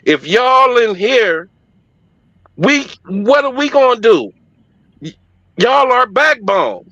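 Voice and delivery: high, urgent rising pleas; pleading church women. Retold or imitated. Women on stage pleading with homeless men, high and urgent. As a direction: scold and plead like a woman at the mic. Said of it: "Them women was up on that stage like".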